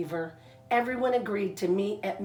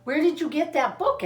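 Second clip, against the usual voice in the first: eager question; 8 semitones higher, 3 dB louder than usual. Acted. Franklin asks Missus Muskrat eagerly.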